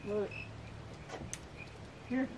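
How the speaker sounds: ordinary delivery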